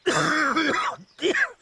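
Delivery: harsh, high hacking cough. Acted. Old sailor fishing for pity, hammed-up cough. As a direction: cough it up like a worn-out old salt. Sailor fakes sickness for money.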